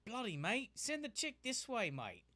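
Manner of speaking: loud mock Aussie-British accent. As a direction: try a British mate, land Australian. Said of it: "That sounds more like Australian".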